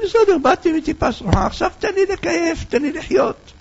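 high, scoffing carefree voice; scoffing hedonist. Acted. A man who came from a putrid drop, shrugging it off in a high mocking voice. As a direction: brush it off with a high mocking lilt.

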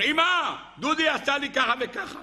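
high-pitched wailing tattletale; retold child's scream. Little brother tells Mom on Dudi, mock screaming. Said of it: "ואז הוא צרח".

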